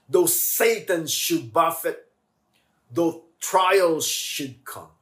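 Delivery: slow, swelling hymn lines; hymn recitation. Acted. Pastor reciting the hymn to the church, reverent. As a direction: read the hymn with feeling. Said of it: "the lyrics".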